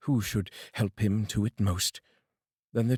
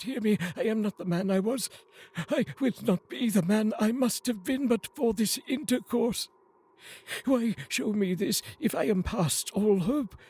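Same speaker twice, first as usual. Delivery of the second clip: desperate begging; 12 semitones higher than usual. Acted. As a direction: beg for your life before the grave. Scrooge begs the spirit.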